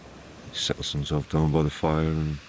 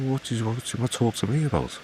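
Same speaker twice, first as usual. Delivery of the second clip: puzzled disbelief; 7 semitones higher than usual. A narrator's reading. Angus puzzled the king wants him.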